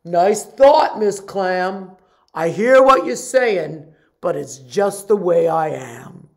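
loud, sulky fish; a stubborn pouty fish; glum fish voice. Acted. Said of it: "says the fish".

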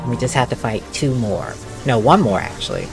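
normal talking voice

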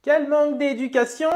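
put-on high-pitched indignant old woman; scandalised old dear; old lady. Old woman scolding rude girls, old-lady voice. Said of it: "l'ancêtre".

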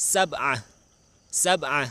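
ซับอะ high and loud, in two syllables; model pronunciation. Shown as a demonstration. Teacher shows children Arabic seven, ซับอะ pronounced high and clearly. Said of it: "ออกเสียงว่า".

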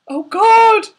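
high, loud frightened cry; stammered. Acted. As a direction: blurt it out in shock. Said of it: "stammered Frank".

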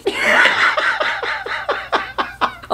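breathy high giggle; giggling at a joke letter; high laugh. Real, not acted. Laughing at the fake envelope.

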